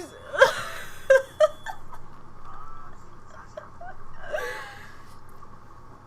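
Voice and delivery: silent high wheeze of laughter; airy squeaking laugh; wheezing laugh. Real, not acted. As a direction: lose breath laughing. Breaks down laughing.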